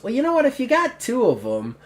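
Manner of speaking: high-pitched goblin voice; high little creature chiming in. Acted. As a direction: pipe up as a small goblin. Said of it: "the goblin actually goes".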